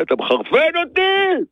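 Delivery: high-pitched, angry outburst; exasperated outburst. Real, not acted. Fed-up seller snapping at a clueless customer, exasperated outburst. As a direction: burst out at the end of your patience. Same complaint bursts out at customer.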